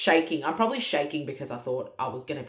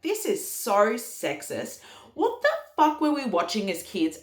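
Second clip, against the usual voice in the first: mock outrage; 6 semitones higher, 4 dB louder than usual. Retells getting pissed off at film.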